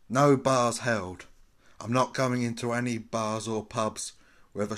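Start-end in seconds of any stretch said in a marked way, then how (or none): 0.1-1.3 loud and slow, every word hit; loud declaration. Pretend. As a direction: proclaim it like a battle cry. Playing with the phrase.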